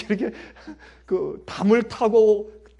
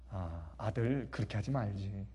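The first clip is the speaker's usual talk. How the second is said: soft, lower, slow motherly plea; gentle mother; 9 semitones lower, 12 dB quieter than usual. Retold or imitated. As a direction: gently ask the son to stop. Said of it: "저희 어머니가 저한테".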